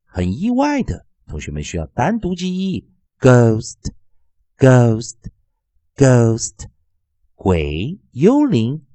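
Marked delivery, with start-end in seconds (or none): 3.2-6.7 "ghost" drawn out with a long O. Shown as a demonstration. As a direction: model "ghost" slowly, silent H, long O. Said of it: "O会念作长元音".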